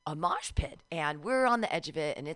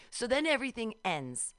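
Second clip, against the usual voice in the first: usual speaking voice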